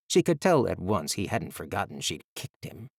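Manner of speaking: plain reading voice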